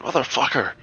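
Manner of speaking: grumpy Scottish dwarf; Scottish accent. Pretend. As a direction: snap it like a fed-up dwarf. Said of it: "randomly generated Scottish insults".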